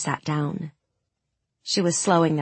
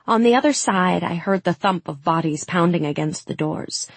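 ordinary delivery

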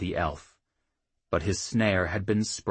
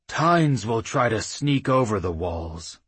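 ordinary delivery, nothing marked

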